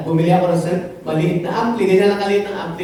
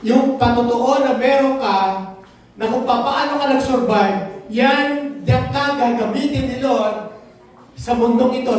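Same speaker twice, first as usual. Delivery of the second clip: ordinary talk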